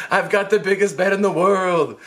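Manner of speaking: thrilled, giddy announcement; excited. Retold or imitated. Teen showing off his new floor bed, excited. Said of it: "I was so excited".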